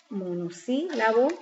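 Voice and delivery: monosílabo drawn out slowly in pieces; sílaba por sílaba. Shown as a demonstration. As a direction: repeat monosílabo slowly for the class. Repeats the term syllable by syllable. (monosílabo dicho lento, por partes)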